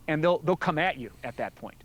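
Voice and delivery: ordinary delivery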